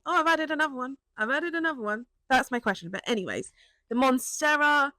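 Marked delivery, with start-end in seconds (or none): 0.1-2.1 offhand announcement. Retold or imitated. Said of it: "rather than me just randomly going".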